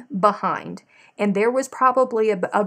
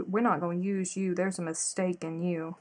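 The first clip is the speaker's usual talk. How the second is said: usual speaking voice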